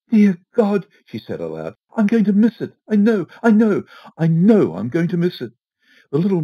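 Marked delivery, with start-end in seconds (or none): 0.1-0.9 high, loud cry of dismay; a desperate outburst; panicky Mrs Foster. A narrator's reading. Wife despairs aloud about missing her plane, high and loud. 2.0-5.5 high, loud, near-tearful insistence; panicking Mrs Foster. A narrator's reading. Wife despairs in the hall, high and close to tears.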